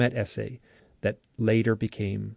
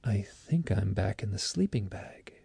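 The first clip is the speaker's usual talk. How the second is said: slow, whispered aftermath; hushed realization; 2 semitones lower, 6 dB quieter than usual. Retold or imitated. Abductee finding himself back in sleeping bag, hushed. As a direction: whisper it slowly, as if just coming to. Retold waking alone after the vision.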